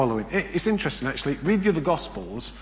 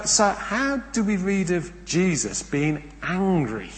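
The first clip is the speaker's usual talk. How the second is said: normal talking voice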